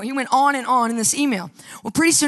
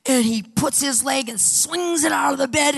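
ordinary talk

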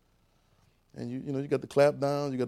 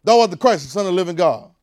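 louder, higher bold declaration; Peter declaring; 4 semitones higher, 10 dB louder than usual. Retold or imitated. Peter answers Jesus, bold and loud. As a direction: proclaim it boldly. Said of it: "Peter say".

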